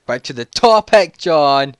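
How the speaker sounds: high, very loud echo; mock nagging shout. Teller echoing friend's nagging, loud, high mock scolding. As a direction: throw it back at him loudly.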